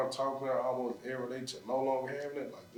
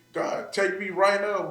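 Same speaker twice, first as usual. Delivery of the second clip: slow, louder, higher mock plea to God; desperate plea; 6 semitones higher, 5 dB louder, slower than usual. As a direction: retell a plea, stretched out and raised.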